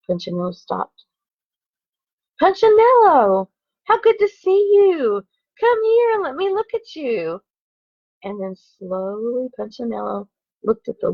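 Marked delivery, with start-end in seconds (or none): 2.4-7.4 hearty welcome from Eli. A narrator's reading. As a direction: call out to him with open-armed delight. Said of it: "The voice was deep and strong".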